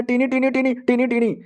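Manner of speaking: high, squeaky creature chant of its name; babbling little demon baby; demonic baby babble. Acted. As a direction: chirp it like a tiny demon child. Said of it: "वो भी कहता है".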